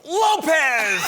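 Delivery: put-on high, loud kid's shout; triumphant blurt. Four-year-old answering the president question, excited blurt.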